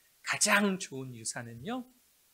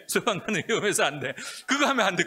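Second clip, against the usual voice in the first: laughing refusal; 5 semitones higher, 9 dB louder, faster than usual. Retold or imitated. Parents repeating refusal, laughing.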